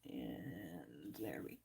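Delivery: a soft breathy whisper, barely voiced. Real, not acted. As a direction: drop to a hushed whisper under the breath. Quiet aside while stamping.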